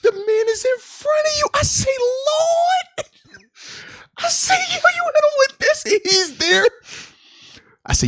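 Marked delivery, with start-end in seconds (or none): 0.0-4.5 much higher, shrill-free squeal of disbelief; squealing with delight at the play; hyped disbelief. Retold or imitated. Hyped reaction retold high. 4.5-6.6 hyped retelling. Retold or imitated. Hyped retelling own reaction.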